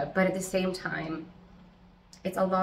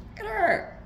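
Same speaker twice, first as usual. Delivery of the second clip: very high squeal; cooing over pet; 14 semitones higher than usual. Real, not acted. Owner fussing over the cat mid-video, high squeal. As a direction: coo it way up high.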